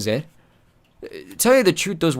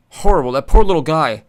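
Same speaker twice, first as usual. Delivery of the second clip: Horrible said much louder and higher, aghast; loud, higher squeamish wail on Horrible; 6 semitones higher, 10 dB louder than usual. Acted. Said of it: "Chips was feeling a bit squeamish".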